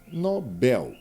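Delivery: "bel" given the main stress; stress on last syllable. Shown as a demonstration. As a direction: lean firmly on the final "bel". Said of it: "aqui no caso forte".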